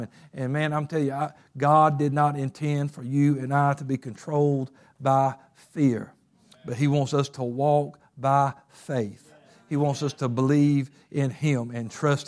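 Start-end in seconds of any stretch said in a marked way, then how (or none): none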